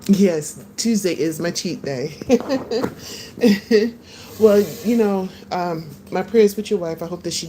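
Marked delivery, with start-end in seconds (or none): 2.2-3.9 high, light chuckle; laughing. Real, not acted. Telling viewers about cheat day, high giggle. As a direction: giggle to yourself.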